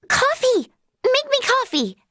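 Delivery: put-on high, childish demand for coffee; whiny demand. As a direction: beg for it like a spoiled kid.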